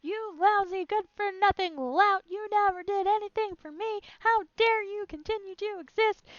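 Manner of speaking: high, loud abusive shriek at her husband; screeching lady. Acted. Woman screaming abuse at her husband, shrill fury. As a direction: scream insults at him with full venom.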